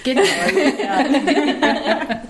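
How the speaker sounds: amused laughing yes. Real, not acted. Host laughs after the exchange.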